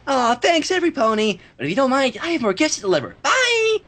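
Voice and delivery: chirpy goodbye, a hint of Pinkie; lightly voiced Pinkie. Reader voices Pinkie Pie.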